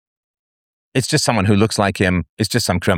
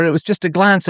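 defensive husband; 11 semitones higher than usual. Retold or imitated.

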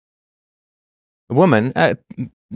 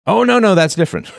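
mock indignant; 10 semitones higher than usual. Mock reply voicing women.

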